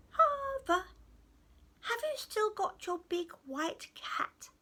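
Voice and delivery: high little goblin voice; small high-pitched goblin; tiny sweet goblin. Acted. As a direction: call out high, then ask innocently. Tiny goblin calls from tree.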